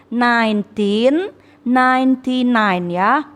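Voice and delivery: "nineteen ninety nine" said slowly and clearly; slow model reading. Shown as a demonstration. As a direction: read the year slowly for students. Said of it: "Jadi yang benar itu". (ucapkan tahun pelan dan jelas)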